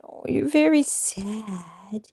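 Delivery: slow cooing to pet. Real, not acted. Cooing at the dog.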